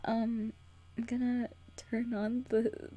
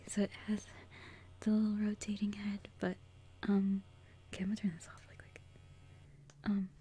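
whispering. Real, not acted. Whispers throughout.

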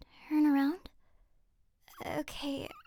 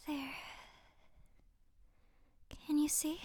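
ordinary talk

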